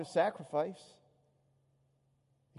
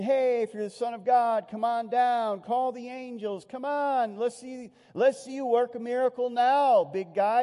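louder, higher jeering dare; mocking taunt; 6 semitones higher, 5 dB louder than usual. Crowd taunting Jesus on the cross, loud mocking jeer. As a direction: sneer it up at him like a heckler.

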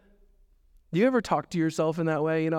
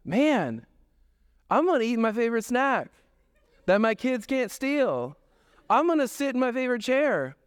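higher, giddy mock glee; dad alone at home, gleeful about snacks and sports; gleeful self-talk; 8 semitones higher than usual. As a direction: gloat like a kid with the house to himself. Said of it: "I'm like".

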